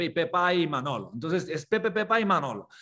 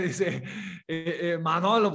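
ordinary talk, nothing marked

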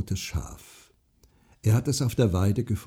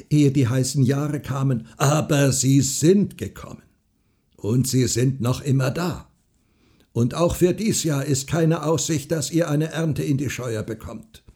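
raised, emphatic farmer's argument; insistent farmer; 6 semitones higher, 5 dB louder than usual. A narrator's reading. Farmer warns the widow about harvest, insistent. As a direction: press each point home, louder.

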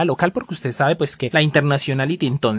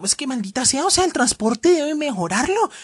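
scolding; 8 semitones higher than usual. Real, not acted. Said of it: "no suene como esté regañando".

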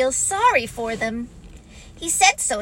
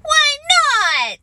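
put-on high, loud childish protest; little one's loud squeal of objection; protesting youngster; 8 dB louder than usual. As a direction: pipe up in outraged surprise. Young Roo protests being left out.

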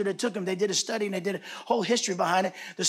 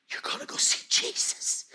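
soft, voiceless reassurance; whispered comfort; 4 dB quieter than usual. Acted. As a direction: whisper it to a dying daughter. Father comforts dying daughter.

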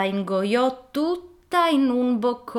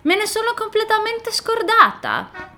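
put-on dismayed little girl; 6 dB louder than usual.

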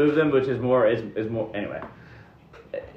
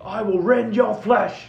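high-pitched, drawn-out villain threat; slow war cry at raised pitch; hammed-up monster taunt; menacing enemy trooper; 10 semitones higher than usual. Game master voices attacking enemy.

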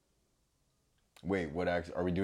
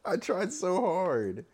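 mock lament; 14 semitones higher, 5 dB louder, much slower than usual. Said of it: "I didn't try my accent that well".

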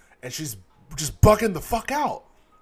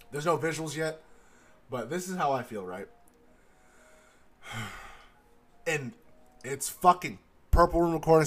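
ordinary delivery

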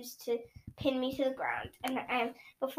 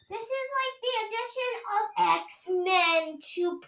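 Garth's voice; 8 semitones higher than usual. Acted. Garth jumping up and down, gushing to friends. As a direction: gush like an excited kid.

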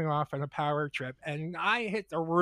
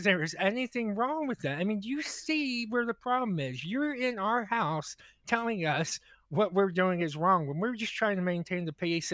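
ordinary talk, nothing marked

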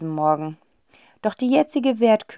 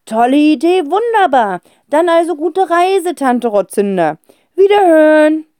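higher, louder hasty cheer; relieved farewell; 9 semitones higher, 8 dB louder than usual. A narrator's reading. Father relieved, hurrying off the phone. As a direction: rush to end the call.

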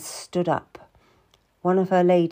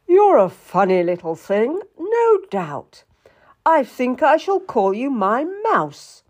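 teasing king, raised pitch and volume; playful royal flirting; flirtatious king; 6 semitones higher, 5 dB louder than usual. A narrator's reading. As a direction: tease a sweetheart in a kingly voice.

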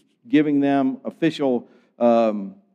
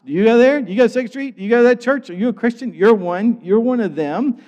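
rapid-fire questions, higher and louder; accusing interrogation; 7 semitones higher, 5 dB louder than usual. Retold or imitated. Saul going house to house hunting Christians, accusing interrogation.

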